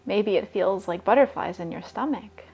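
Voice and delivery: guided meditation. Acted. [name] guiding listeners to raise their vibration, soft meditation voice. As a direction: breathe the words out calmly, leaving long pauses.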